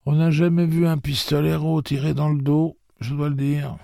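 put-on high, firm old woman voice; old woman stating her case. Maud voiced in dialogue.

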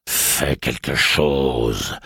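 very slow, low, seething; enraged thought. Acted. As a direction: grind out each word in fury. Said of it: "pensa le pistolero rageur".

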